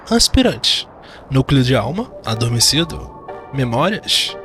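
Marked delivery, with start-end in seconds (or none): none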